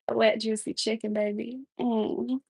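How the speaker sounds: soft, sultry come-on, fading to a hush; mock seductive murmur; fake sexy cooking voice. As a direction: coo it like a seductive cooking show host. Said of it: "my fake cooking sexy voice".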